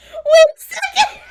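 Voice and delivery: high, loud, laughing shout; excited shout. Real, not acted. Streamer delighted by the chatbot's reply, shouting.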